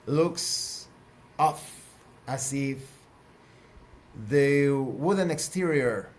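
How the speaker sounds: slow, suspenseful reveal in short fragments; game master narration. Acted. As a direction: pause weightily before each new detail.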